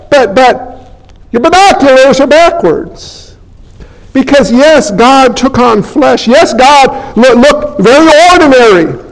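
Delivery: usual speaking voice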